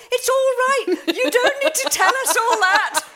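high, loud, laughing mock protest; exasperated laughing retort. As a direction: laugh it off like a bored reader pleading to skip. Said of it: "And you're thinking".

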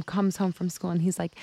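ordinary delivery

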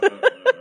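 short, high burst of real laughter; high giggle. Real, not acted. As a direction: giggle at your own bad accent. Laughing after the accent bit.

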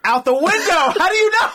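loud, shrieking high question; real astonishment. Real, not acted. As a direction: squeal in amused disbelief. Startled at friend's correct guess.

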